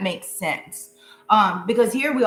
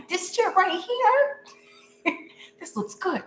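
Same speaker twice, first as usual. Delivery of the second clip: laughing; 9 semitones higher, much slower than usual. Real, not acted. Laughing over a good card.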